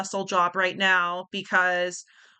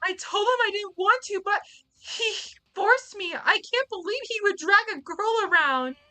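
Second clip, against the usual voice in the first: put-on high whiny girl voice; 10 semitones higher than usual. Voicing the dragged character's complaint.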